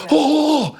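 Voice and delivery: awed oh. Retold or imitated. Tom awed watching wrestling.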